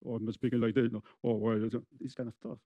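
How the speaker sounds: low, lifeless demo voice; mock flat intonation. Shown as a demonstration. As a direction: show how a dull voice kills the emotion.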